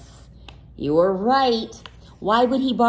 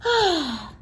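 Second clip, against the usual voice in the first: contented sigh; 8 semitones higher than usual. Acted. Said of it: "contented sigh".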